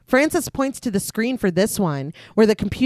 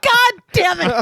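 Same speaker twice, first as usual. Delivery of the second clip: exasperated; 9 semitones higher, 4 dB louder than usual. Retold or imitated. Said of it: "I was like".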